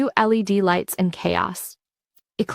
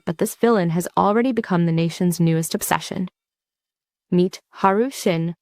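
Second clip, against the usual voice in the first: plain reading voice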